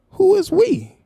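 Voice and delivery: very high, loud disbelief; incredulous squeak. Real, not acted. Streamer asks the absent guest who "we" is, shrilly incredulous. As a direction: shriek the question in disbelief.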